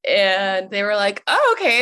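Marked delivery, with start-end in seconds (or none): 1.3-1.8 high, loud imitated surprise; shocked but fine. Siblings reacting to her coming out, imitated shock. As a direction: take the news with a startled, accepting yelp. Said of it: "They were shocked, but, like, fine with it".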